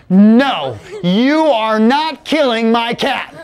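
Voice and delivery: slow, emphatic refusal, word by word; acted indignant protest. Little sister forbidding Jacob to use her cat, indignant. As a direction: put your foot down as a little sister.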